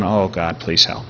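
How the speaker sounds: hushed, desperate little prayer; small, low-volume plea for help; quiet plea to God. Retold or imitated. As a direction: plead softly under your breath. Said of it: "he's going".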